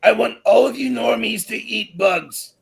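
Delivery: high, loud villain voice; a hammed-up globalist villain; Klaus impression. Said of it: "Mister Klaus".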